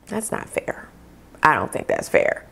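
soft unvoiced protest; whispered objection. Real, not acted. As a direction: mutter it under your breath. Objecting to an unfair review.

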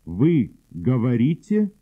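usual speaking voice

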